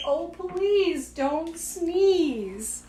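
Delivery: very slow drawn-out plea; slow pleading. Acted. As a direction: drag out each word, begging. Begging elephant not to sneeze.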